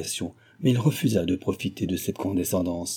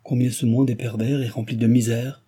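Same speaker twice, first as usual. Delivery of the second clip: ordinary delivery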